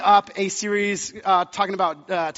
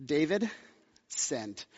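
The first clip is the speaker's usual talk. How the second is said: low, soft, self-satisfied delivery; mock-smug, with a pause before the last word; smug pride; 5 semitones lower, 9 dB quieter, much slower than usual. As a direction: act out quiet, prideful triumph. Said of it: "prideful moment".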